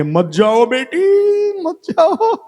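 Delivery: high, drawn-out acted wail; pleading mother. Earthly mother begs daughter not to go, high pleading. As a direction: beg her to stay, pitch climbing high.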